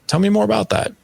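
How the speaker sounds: a warm invitation to his son to talk; inviting. Shown as a demonstration. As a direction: open the door with a bright high lift. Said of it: "is the invite".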